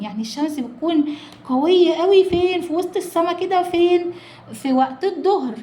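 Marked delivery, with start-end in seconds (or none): 1.5-4.0 eager quiz. Real, not acted. Teacher quizzing pupils eagerly.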